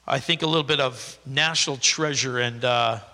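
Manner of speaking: normal talking voice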